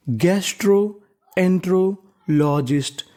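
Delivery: Gastroenterologist said slowly, piece by piece; slow teaching read. Shown as a demonstration. Teacher sounding out a long word for students, slowly. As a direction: draw out gastroenterologist for learners. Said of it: "sounding out new word".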